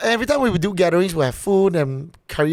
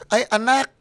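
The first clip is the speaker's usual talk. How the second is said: lilting, higher Filipino mother voice; Filipino mom; 4 semitones higher than usual. Retold or imitated. Son mimicking his mom asking him to dance, Filipino mom accent. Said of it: "All Filipino moms sounds like that".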